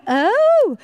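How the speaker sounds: high, loud oh of surprise; be caught off guard; surprised oh. Retold or imitated. Surprised at the answer.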